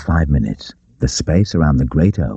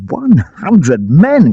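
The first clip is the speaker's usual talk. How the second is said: slow, high and loud with glee; gleeful cry; 13 semitones higher, 5 dB louder, much slower than usual. A narrator's reading. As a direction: savour the number with delight. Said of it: "cried Robin gleefully".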